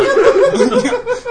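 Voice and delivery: sudden laugh; laughing burst; high laugh. Real, not acted. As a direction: crack up. Laughing mid story.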